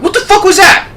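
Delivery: high-pitched, imitated bewilderment; stunned disbelief. Stunned customer asking the captain about screams, high mock disbelief. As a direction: demand it in high, baffled shock.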